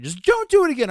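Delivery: high, loud mock warning; imitating scolding wife. Wife warns prankster husband, imitated high loud scolding. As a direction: lay down the law in her high voice. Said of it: "she comes in and yells at me".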